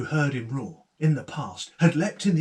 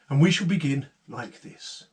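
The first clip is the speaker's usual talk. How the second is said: plain reading voice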